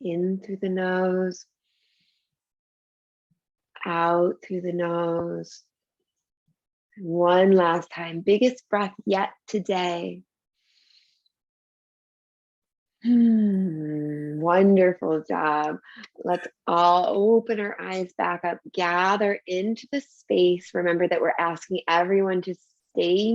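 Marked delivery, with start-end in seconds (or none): 0.0-5.6 slow, drawn-out breathing cue. Shown as a demonstration. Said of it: "Take three more of those deep". 7.0-20.7 slow, calm breathing guidance; guided meditation. Acted.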